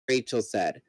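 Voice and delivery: ordinary delivery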